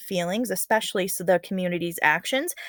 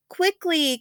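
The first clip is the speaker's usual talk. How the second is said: higher, urgent falling call of quickly; 9 semitones higher than usual. Acted. Old woman urging the maidens to weave, urgent. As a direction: press them to hurry.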